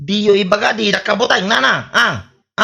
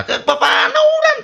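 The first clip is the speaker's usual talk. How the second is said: laughing. Acted. Bragging villager laughs mid-boast.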